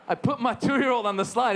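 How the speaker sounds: usual speaking voice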